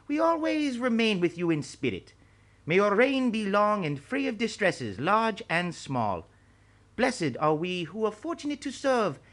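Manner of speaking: an ancient sage bidding an emperor farewell; old master, ceremonious farewell. A narrator's reading.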